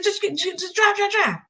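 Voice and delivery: high, stumbling mock panic; frantic urging. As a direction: imitate a passenger begging the driver to go. Retelling a panicked getaway.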